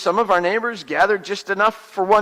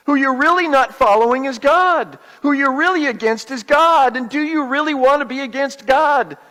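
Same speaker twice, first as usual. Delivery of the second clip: higher, impassioned exasperated rebuke; angry pleading; 4 semitones higher, 3 dB louder than usual. Acted. Moses telling complainers it is God they oppose, angry. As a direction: drive the questions home, voice raised in pitch. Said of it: "Moses was angry because we weren't".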